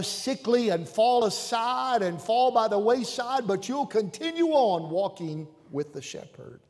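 high, loud preaching cadence; full-force preaching. Acted. Preacher driving home the psalm to his flock, full force. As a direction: preach it out to the congregation.

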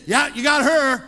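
loud, gloating egging-on that builds to a shout; mock vindictive cheering. Preacher mimicking a spiteful prayer to God, gloating loudly. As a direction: cheer on the punishment with hammed-up relish.

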